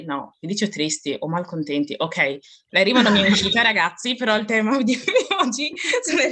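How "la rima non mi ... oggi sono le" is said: talking through a giggle; words broken by laughter; laughing. Real, not acted. Laughing through rhyme slip.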